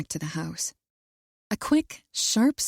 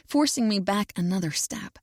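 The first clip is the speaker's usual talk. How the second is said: usual speaking voice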